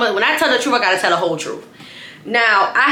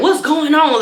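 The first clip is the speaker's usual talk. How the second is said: higher, lost and bewildered; confused drunk; 7 semitones higher, 3 dB louder, slower than usual. Retold or imitated. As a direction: ask like you're drunk and confused.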